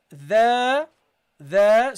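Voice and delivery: loud, drawn-out "the"; demonstrated recitation. Shown as a demonstration. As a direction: hold "the" out firmly, as in recitation. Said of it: "you have to read like this".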